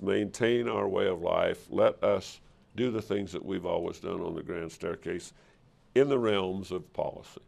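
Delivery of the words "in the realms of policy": ordinary delivery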